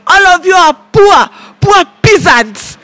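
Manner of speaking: high yelled insult, slowing at the end; mock shout. Mocking fellow passengers, a high scornful shout. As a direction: shout down at the poor.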